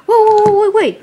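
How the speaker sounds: high, loud protest; alarmed. Acted. Mario objecting to the sudden trip, alarmed. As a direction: jump in to halt him.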